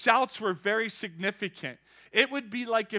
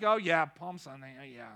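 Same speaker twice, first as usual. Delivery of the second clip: offhand, fading to a near-silent Hosanna; mock bored churchgoer; ordinary, bored; 7 semitones lower, 5 dB quieter, faster than usual. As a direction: shrug it off as if it means nothing. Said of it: "They just become like".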